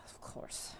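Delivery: very soft, whispered reply; whispered acceptance; hushed assent. Acted. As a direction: breathe it out under your breath. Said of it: "You watch the spirit goes".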